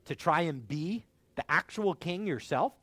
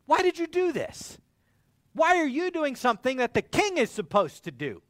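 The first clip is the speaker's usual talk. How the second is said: higher, nervous challenge of a lesser king; timid confrontation; 10 semitones higher, 6 dB louder than usual. Retold or imitated. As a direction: work up the nerve and ask it, voice climbing. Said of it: "gets up his courage to go confront Abner".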